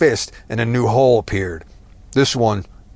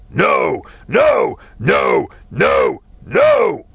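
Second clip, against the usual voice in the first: higher, louder barked noes; furious shouts; 5 semitones higher, 5 dB louder, much slower than usual. Acted. Red-faced man alone at the window, shouting furiously. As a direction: rage at the empty street.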